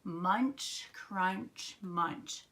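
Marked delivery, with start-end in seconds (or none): none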